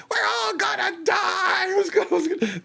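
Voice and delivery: a hammed-up movie scream of doom; mock hysterical cry. Host quoting the Airplane panic scene, high mock wail. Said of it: "she goes".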